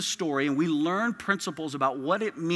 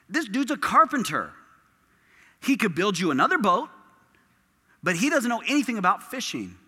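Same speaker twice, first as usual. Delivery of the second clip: higher, louder mock-incredulous friend; incredulous scoffing; 6 semitones higher, 5 dB louder than usual. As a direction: scoff in loud, high-pitched disbelief. Peter's friends scoff at him.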